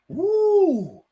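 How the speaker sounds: very high, long, loud woo; excited whoop. Real, not acted. Watchalong host reacting to a Fulham shot, excited high whoop. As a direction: let out a drawn-out high whoop.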